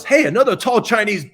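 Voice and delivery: high, loud delight cut short; cheery last thought. Retold or imitated. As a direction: call it out brightly, then slam into it. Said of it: "The last thought is".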